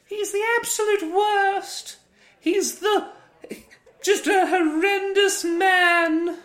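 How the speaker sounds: very high, slow, loud joke rant; mock ranting shout. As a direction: scream it like a hammy villain. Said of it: "we're all joking".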